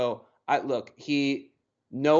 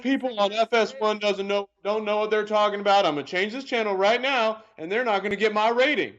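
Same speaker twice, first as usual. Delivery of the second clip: high put-on complainer voice; mocking imitation of an outraged channel-changer; mock indignant viewer; 7 semitones higher than usual. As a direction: imitate a huffy viewer, pitched up.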